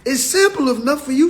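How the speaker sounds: lightly hammed-up frustration; exasperated high voice. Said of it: "I'm like".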